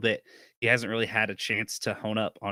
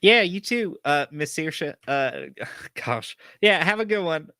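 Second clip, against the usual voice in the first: put-on bashful farm boy; 5 semitones higher, 5 dB louder than usual. Gus bashful, leaving.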